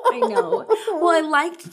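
breaks into a high laugh on the words; laughing at the friend's joke. Real, not acted. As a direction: giggle out the words.

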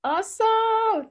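bright slow awesome; enthusiastic. Real, not acted. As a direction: stretch it out with glee. Enthusiastic sign-off.

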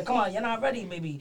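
usual speaking voice

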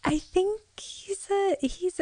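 whispered, high and hesitant; unsure whisper. Real, not acted. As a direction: breathe it out, unsure. Unsure about ape or gorilla.

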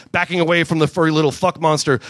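ordinary talk

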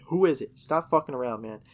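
scared yell at whatever is outside. Retold or imitated. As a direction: shout it out at the door. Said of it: "We keep yelling outside".

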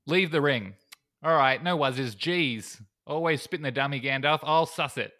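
hammed-up Kiwi banter; bad New Zealand accent. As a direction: badly imitate a laid-back New Zealander. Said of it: "I'll stop the terrible New Zealand thing".

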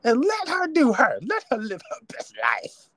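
high sassy defending voice; mocking supportive women. Host mimicking women defending [name], high sassy. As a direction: snap back like a fan defending her. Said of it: "The women were saying".

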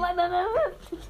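high, loud repeated no; loud protest. Real, not acted. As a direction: cry out no, no, no. Protesting the other's claim.

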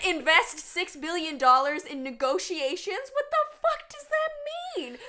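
high loud incredulous; outraged disbelief, nearly laughing; exasperated. Real, not acted. Exasperated rant about liberals.

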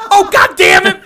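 very high, loud frustrated outburst; fed up at the shift request; exasperated curse. Retold or imitated. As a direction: coworker blurting out in exasperation. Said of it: "the kid goes".

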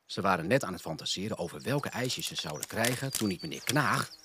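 ordinary delivery, nothing marked

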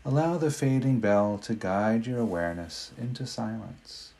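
ordinary delivery

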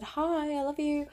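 retold starstruck greeting; excited fan call. Calling out to a star.

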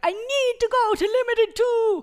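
put-on high, loud, gushing teen shopper; excited teen girl. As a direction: squeal it like a mall-crazy kid. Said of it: "I was like".

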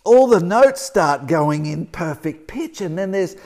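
friend's quote swelling into elation; rising joy. Retold or imitated. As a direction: lift it like a revelation. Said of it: "but she said".

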